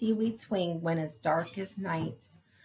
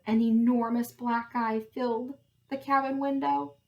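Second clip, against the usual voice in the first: usual speaking voice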